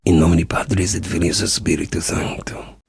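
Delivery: breathy whispered Latin prayer, low. Acted. Templar murmuring a Latin prayer to God, whispered. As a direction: hush it like a secret prayer.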